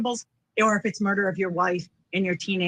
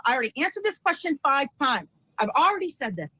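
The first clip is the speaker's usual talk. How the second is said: raised pitch, mock protest; tone going up; 6 semitones higher than usual. Shown as a demonstration. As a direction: lift the voice like someone overselling a denial. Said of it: "when our tone goes up".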